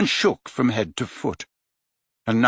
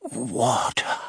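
croaking; 4 dB quieter than usual. A narrator's reading. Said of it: "croaked Dumbledore".

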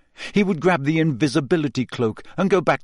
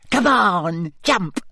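teasing mermaid; 6 semitones higher, much slower than usual. Acted. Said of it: "she giggled maliciously".

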